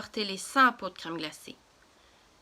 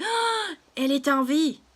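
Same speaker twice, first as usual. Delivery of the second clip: high startled yelp, then a louder exclamation; startled cry; 6 semitones higher, 5 dB louder than usual. Acted. Man realizing the doll is alive: high startled cry. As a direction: jump out of your skin with fright. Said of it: "L'homme crie".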